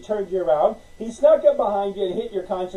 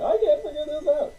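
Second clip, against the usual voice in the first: whiny complaint; 7 semitones higher than usual. Retold or imitated. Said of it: "people who keep saying that".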